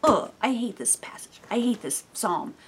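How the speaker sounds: hushed, exasperated whisper; whispered frustration. Retold or imitated. Reading the psalm alone, whispering her frustration. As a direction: whisper it like a reader fed up with the passage. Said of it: "I was just like".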